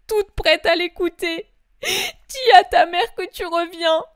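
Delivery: tearful, rising panic; faltering, tearful mother's voice; frantic Mary. Acted. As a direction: her reason slipping, pleading for help. Mary in tearful doubt and prayer.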